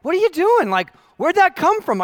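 mock the coach's astonishment; surprised coach. Coach calling out to young [name], lightly voiced surprise. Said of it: "my coach is like".

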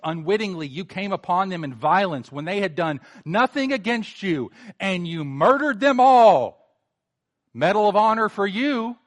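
mock a bully with false admiration, rising to a loud jeer. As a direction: drip sarcasm, then swell into a loud sneer. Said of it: "See, [name]'s mocking him".

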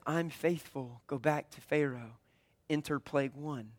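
calm voice of God. Acted. God answers Moses calmly.

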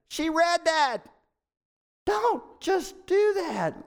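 high, slow, dragged-out mocking disbelief; mock exasperation. Pastor mocking a churchgoer's vitamin advice, high and drawn out. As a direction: stretch it out like you can't believe it.

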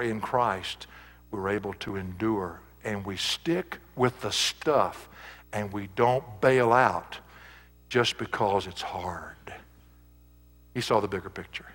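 hushed. Acted. Preacher drops to hushed conclusion.

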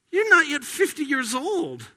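high, mocking disbelief; scoffing crowd. Preacher voices the scoffing crowd, mocking. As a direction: scoff at him as the doubting crowd.

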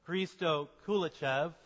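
ordinary delivery

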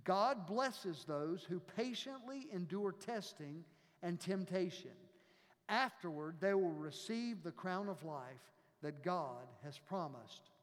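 calm scripture reading from the pulpit. Acted. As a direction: read the passage like a lector. Reads scripture aloud.

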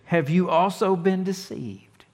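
high, slow, condescending mock question; talking down to idiots. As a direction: ask it as if they were idiots. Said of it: "Talk to 'em like they're idiots".

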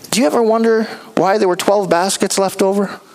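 normal talking voice

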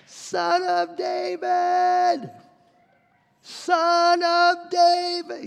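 very long, high held call. Acted. Rebuked beggar ramping up his plea to Jesus, crying out. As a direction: stretch every word into a far-carrying wail. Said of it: "he cried out all the more".

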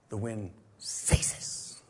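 slow, hushed whisper; breathy awed whisper of stillness. Acted. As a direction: let the hush fall after the storm. Sudden calm after rebuke.

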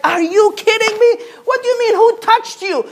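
hammed-up frantic father who can't believe the delay; exasperated Jairus. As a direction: Jairus to Jesus in the crowd, frantic and incredulous, high pitched. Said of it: "And Jairus will be like".

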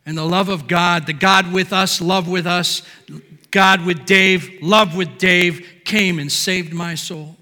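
loud, slow, rising declaration; fiery preaching. Acted. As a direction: build to the climax of salvation. Preached climax of testimony.

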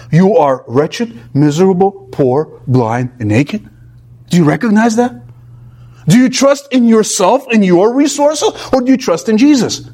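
usual speaking voice

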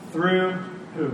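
ordinary talk

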